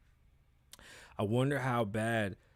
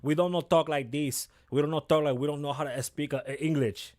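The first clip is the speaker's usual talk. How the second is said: mocking TV Spanish accent; 4 dB louder than usual. Host mocking how TV casts Spanish characters, thick mock accent. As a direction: parody the clumsy accent TV gives Latinos. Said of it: "how they project Spanish people".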